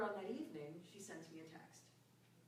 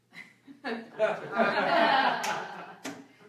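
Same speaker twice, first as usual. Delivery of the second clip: long, loud burst of real laughter; real hearty laugh; 4 semitones higher, 16 dB louder than usual. Real, not acted. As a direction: crack up out loud. Big laugh at own thought.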